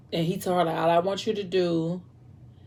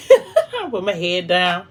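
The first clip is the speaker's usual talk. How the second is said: real laugh breaking into the words; 7 dB louder, slower than usual. Real, not acted. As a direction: laugh through the line, louder and slower. Laughs at own tiredness.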